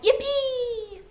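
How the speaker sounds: very high, drawn-out yippee; whooping cry. A narrator's reading. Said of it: "cried out".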